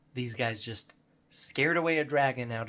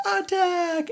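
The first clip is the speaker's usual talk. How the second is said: very high, loud charge cry; hammed-up high-pitched war whoop; mock battle cry; 7 dB louder than usual. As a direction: imitate eager players yelling to charge. Imitates players' battle cry.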